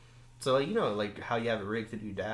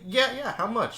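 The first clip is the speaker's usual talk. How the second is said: higher, eager own voice as retold; eager retelling; 11 semitones higher, 3 dB louder than usual. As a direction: play up his keen younger self asking the price. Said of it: "And so I was like".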